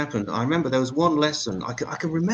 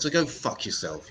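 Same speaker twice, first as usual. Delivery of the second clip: usual speaking voice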